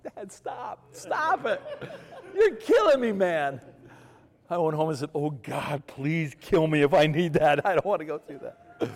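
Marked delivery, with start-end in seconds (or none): 0.0-3.6 high-pitched comic pleading, building to loud; squealed mock desperation; exasperated begging. Son begging weepy dad to stop. 5.1-8.9 loud mock prayer in horror; hammed-up begging; desperate plea. Horrified son praying not to need it.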